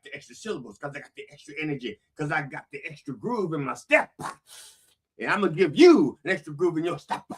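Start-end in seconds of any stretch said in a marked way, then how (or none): none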